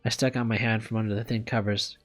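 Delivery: plain reading voice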